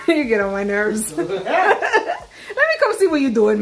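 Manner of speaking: laughing. Real, not acted. Laughing, teasing.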